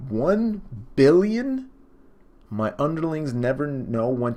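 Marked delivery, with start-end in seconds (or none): 0.1-1.7 high, loud, slow disbelieving gasp of a question; can't believe the number he just read; stunned disbelief. Real, not acted. Reader stunned by bounty, high loud disbelief.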